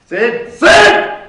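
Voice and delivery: shouting to get Sid's attention on the line; calling out. Acted. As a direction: yell to cut in on the phone. Trying to interrupt caller.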